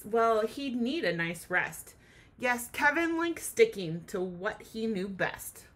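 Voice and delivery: normal talking voice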